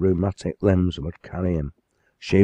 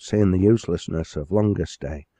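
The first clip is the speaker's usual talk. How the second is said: plain reading voice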